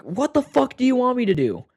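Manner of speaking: high, loud angry shout at mom; retold angry yell. Teen yelling at his mom mid-argument, loud and angry. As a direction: blast it out as a furious teen. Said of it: "something along the lines of, like".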